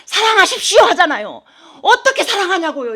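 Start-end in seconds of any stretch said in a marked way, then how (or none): 0.0-0.8 higher, louder preachy exhortation, a touch mocking; mocking the pastors who tell the flock to love, preachy; mimicking pastors. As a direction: imitate a typical pastor urging love. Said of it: "많은 목사님들이".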